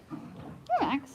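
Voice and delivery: soft whispered call to dog. Real, not acted. Calling dog softly.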